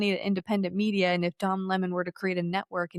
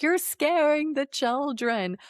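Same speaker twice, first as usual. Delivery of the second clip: higher, slow, whiny mock churchgoer; 6 semitones higher, much slower than usual. Churchgoers scolding the protesters, higher, slow and mocking. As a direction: drag it out high, mocking their pearl-clutching. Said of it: "then they were like".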